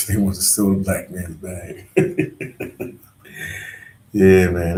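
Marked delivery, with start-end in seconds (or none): 1.9-2.9 short real laugh. Real, not acted. Laughs at his own stolen bag.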